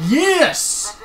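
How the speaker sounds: loud, high triumphant yes; victory shout; excited yes. Real, not acted. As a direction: celebrate the sub goal. Celebrating sub points goal.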